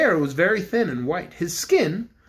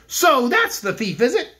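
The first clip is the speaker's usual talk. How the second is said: angry; 7 semitones higher, 4 dB louder than usual. A narrator's reading. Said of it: "said Cake in an angry voice".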